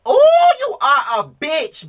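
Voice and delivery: long, high, slow shout; screaming. Real, not acted. Vlogger screaming at a reality star on camera. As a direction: scream it out and drag the ooh. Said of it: "the way I screamed and yelled".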